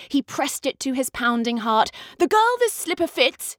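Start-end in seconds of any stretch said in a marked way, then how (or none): 2.2-3.6 higher, grand announcing voice; proclaiming prince. A narrator's reading. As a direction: declare it like a royal decree. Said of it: "he cried".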